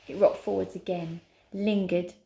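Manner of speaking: usual speaking voice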